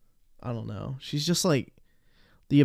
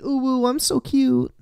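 mocking girly voice; 11 semitones higher than usual. Mocking her cutesy persona.

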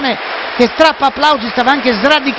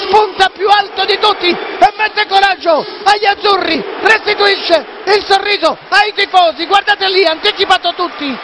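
high, fast excited play-by-play; excited commentary; 7 semitones higher than usual. Real, not acted. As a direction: keep the thrill going. Excited goal recap.

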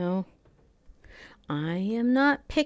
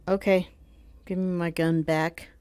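ordinary talk, nothing marked

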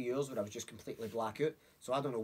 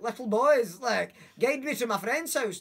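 louder, higher call, slowed; 9 semitones higher, 8 dB louder, slower than usual. Retold or imitated. Calling wee boys on scooters for directions, loud and slow. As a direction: shout across the street at the kids.

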